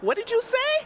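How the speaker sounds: retold double take, voice leaping up; astonished retort. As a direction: shoot the question way up, imitating astonishment. Retells startled reply to granddaughter.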